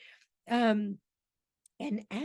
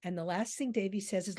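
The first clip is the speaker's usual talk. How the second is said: ordinary delivery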